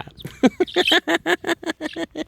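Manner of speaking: long, high giggling laugh; high-pitched laugh. Real, not acted. Owner amused by the waking rooster, giggling. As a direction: burst into a high cackle.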